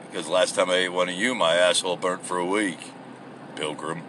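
low, soft cowboy voice, quickly delivered; mock cowboy. Crocodile answering the prisoner as a cowboy, low mock cowboy voice. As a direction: drop way down into a hushed cowboy impression.

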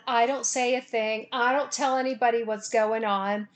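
ordinary talk

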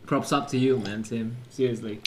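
normal talking voice

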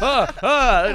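loud, high, flustered "ah" cries; cartoonishly overexcited gasps; mock excited cries. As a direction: cry out twice, high and loud. Acting out stunned teenage excitement.

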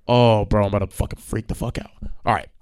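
ordinary talk

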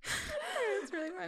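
high laugh-cracked voice. Real, not acted. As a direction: say it through laughter. Laughing hard.

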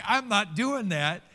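high, loud, slow digging-in; mock stubborn refusal. Churchgoer refusing to bless those who curse him, mock defiant. Voices a listener refusing the command.

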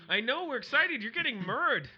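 high, loud cheer for the groom; teasing a friend about his wedding, excited high cheer. Real, not acted. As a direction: cheer him on, delighted.

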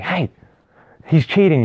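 high, loud accusing shout; calling out a cheater. Acted. Classmates catch someone peeking, calling out in accusation. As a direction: pipe up loudly to expose the cheat. Acted kids catching a peeker.

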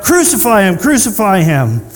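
crying out. Acted. Leaders to Pilate, crying out. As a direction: call for blood like an angry crowd.

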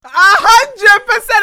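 loud, slow, drawn-out emphatic yes; emphatic agreement. Real, not acted. Agreeing about gossip in Jannah, loud and slow. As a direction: stretch it out loud and certain.

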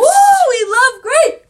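high, loud whoop of joy; real excited shout; excited cheer. Real, not acted. Cheering about favourite colour.

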